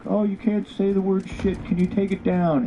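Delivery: a prim, scandalised voice of management, played for ridicule; mocking prissy office voice. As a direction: sneer it like a pearl-clutching office suit. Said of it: "the office was like".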